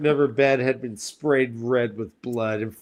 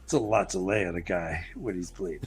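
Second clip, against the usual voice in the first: quiet; 7 semitones lower, 7 dB quieter than usual. Retold or imitated. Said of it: "He's like".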